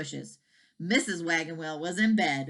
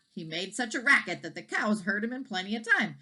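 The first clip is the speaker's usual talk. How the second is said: plain reading voice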